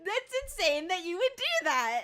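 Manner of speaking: high, loud, laughing disbelief; incredulous amusement. Real, not acted. Streamer asking prankster why they would do this, incredulous squeal.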